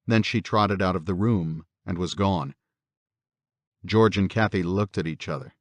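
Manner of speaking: usual speaking voice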